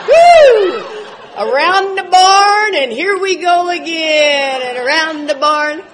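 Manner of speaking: high, loud, slow sing-song mockery of a rambling tale; mock roundabout storytelling. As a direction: drag it round and round like a story that never ends. Mocking Ron's long-winded story.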